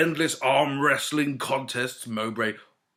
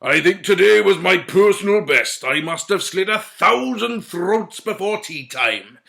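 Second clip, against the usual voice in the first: loud, swaggering brag; boastful warlord; 5 semitones higher, 6 dB louder than usual. A narrator's reading. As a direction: brag like a drunken brute lord. Mowbray boasting to knights.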